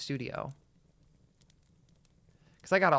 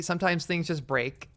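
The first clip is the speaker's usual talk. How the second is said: usual speaking voice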